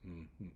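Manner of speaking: "Mm" as a short closed-lip hum; two separate "mm" hums, no vowel; isolated M sound. Shown as a demonstration. Teacher introducing M to students, isolated humming sound. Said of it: "It also has one sound".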